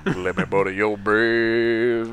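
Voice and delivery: slow low crooner purr; breathy vintage purr. Pretend. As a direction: purr it like an old lounge singer. Crooning a seduction bit.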